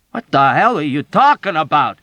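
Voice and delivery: loud, high, exasperated yell; bewildered furious shout. Acted. Yossarian shouting in furious bewilderment at the old woman. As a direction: shout your confusion at her. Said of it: "shouted at her in bewildered, furious protest".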